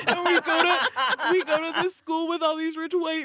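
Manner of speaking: put-on high, sweet innocent-girl voice; high sugary mock innocence; sweet angel girl. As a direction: plead sweetly to the police. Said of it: "these very sweet angel girls".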